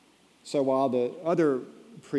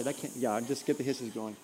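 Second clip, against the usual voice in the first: ordinary talk, nothing marked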